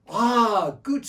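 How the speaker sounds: high, loud pleased exclamation; Bedou voice. Acted. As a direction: exclaim like an eager old man. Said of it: "said the Bedou".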